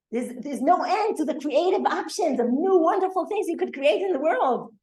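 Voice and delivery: a hint of a rabbi delighting in good deeds; enthusiastic retort.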